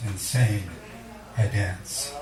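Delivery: ordinary talk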